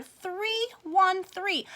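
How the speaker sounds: ordinary delivery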